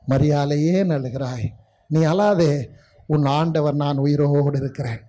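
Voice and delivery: soft, low, consoling words; tender comfort. Acted. Risen Jesus to weeping Mary, tender comfort. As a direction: hush someone's weeping gently, as an acted quote.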